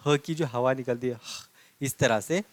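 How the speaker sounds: usual speaking voice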